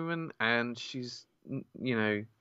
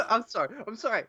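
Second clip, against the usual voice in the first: laughing; 6 semitones higher, much faster than usual. Real, not acted. Laughing apology mid-intro.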